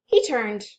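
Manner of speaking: plain reading voice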